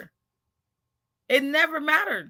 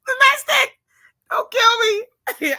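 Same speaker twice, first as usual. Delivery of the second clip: high laughing shout, slowed on the plea; laughing cry; 10 semitones higher, 6 dB louder, slower than usual. Real, not acted. Laughing at the domestic goddess who might kill her, high and loud. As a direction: squeal it through laughter.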